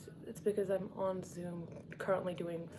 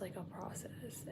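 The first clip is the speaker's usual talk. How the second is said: hushed whisper; 11 dB quieter, slower than usual. Real, not acted. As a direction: whisper so the call can't hear. Whispers while others on Zoom.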